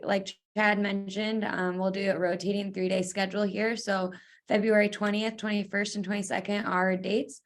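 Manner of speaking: ordinary talk, nothing marked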